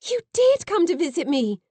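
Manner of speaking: a loud, high, breathless burst of joy; overjoyed, with a catch in the voice; gasped with joy. A narrator's reading. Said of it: "I gasped".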